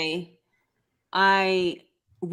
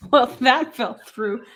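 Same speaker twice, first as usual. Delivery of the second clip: deadpan; 4 semitones higher, 5 dB louder than usual. Real, not acted. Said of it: "The way you just said that with a straight face".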